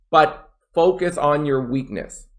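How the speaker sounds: ordinary delivery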